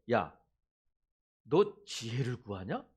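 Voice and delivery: slow, stern mock reproach; a drawn-out, half-serious rebuke; mock scolding. God imagined rebuking someone asking for wisdom, slow mock scolding. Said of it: "이렇게 꾸짖지 않으신다는 거야".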